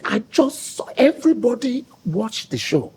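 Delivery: father proudly praising his son on the phone. Retold or imitated. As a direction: play the beaming father. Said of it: "He said".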